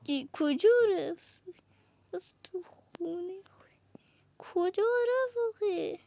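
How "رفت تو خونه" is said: soft unvoiced whisper. Real, not acted. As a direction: whisper it to yourself. Whispered aside while playing.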